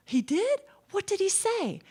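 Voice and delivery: high-pitched, astonished questioning; amazed hairdresser. Acted. Hairdresser hears the lady met the Pope, amazed. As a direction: react in eager disbelief, pitch jumping up.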